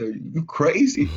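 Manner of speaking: high, loud, slow mock shout; father teasing. Father teasing his son about the fortune, high mock shout. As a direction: tease him like his father did.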